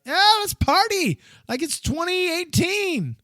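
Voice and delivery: high-pitched, whooping party cry. Acted. Friends reuniting at Comic Con, mock party whoop. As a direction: whoop it up like a reveler.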